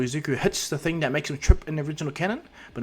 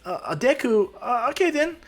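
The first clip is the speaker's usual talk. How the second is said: hesitant; 11 semitones higher than usual. Acted. Taken aback by a new nickname.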